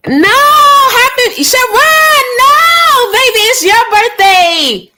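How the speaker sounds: high, loud, delighted screaming; excited shriek. Real, not acted. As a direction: scream with joy at a friend's birthday. Excited birthday greeting to a viewer.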